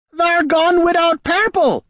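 high, shrill apparition shriek. A narrator's reading. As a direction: screech it like a ghostly hag. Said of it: "she shrieked".

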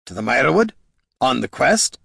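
exclaimed reminder, rising at the end; exclaiming. A narrator's reading. Dwarf reminding the sleepy ranger of the quest, exclaiming. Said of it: "exclaimed Gorm".

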